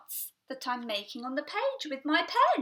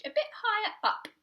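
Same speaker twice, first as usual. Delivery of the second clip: "a bit higher up" lifted much higher in pitch; "a bit higher up" said high to show a mark placed higher; 8 semitones higher, 5 dB louder than usual. Shown as a demonstration. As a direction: raise the voice to show higher on the page.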